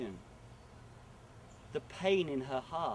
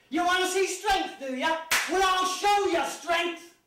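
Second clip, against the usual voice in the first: loud, high taunting shout; defiant challenge; 13 semitones higher, 7 dB louder than usual. Acted. Man answers the laughing animals with a loud high defiant challenge. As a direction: throw the challenge back at them.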